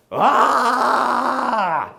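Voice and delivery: loud high "ah" scream; acted scream. Jumping at a supposed intruder in the doorway, acted scream. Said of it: "I'm a screamer".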